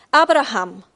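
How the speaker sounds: loud call of the name "Abraham"; the voice of God calling Abraham loudly. Acted. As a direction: call "Abraham" out across a distance. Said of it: "rief Gott".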